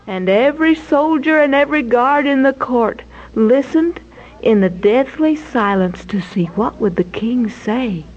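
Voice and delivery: usual speaking voice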